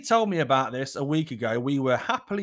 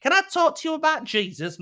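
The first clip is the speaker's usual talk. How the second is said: high, sweet doorstep preacher voice; chirpy knock-at-the-door evangelist; mock Jehovah's Witness at the door; 11 semitones higher, 4 dB louder than usual. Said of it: "She's like a Jehovah's Witness".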